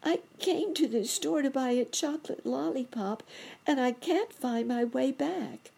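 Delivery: put-on high, tiny child-like voice; a little lost chipmunk calls out high; crying lost chipmunk. A narrator's reading. Lost chipmunk answering the rabbit, high small voice. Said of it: "cried the voice".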